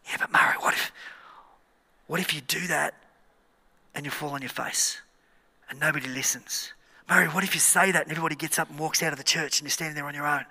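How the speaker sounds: hushed, insidious tempter's whisper; enemy's whisper. Acted. Devil taunting the preacher about failing in church, whispering. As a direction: whisper worries into his ear. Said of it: "And the enemy whispers doubt".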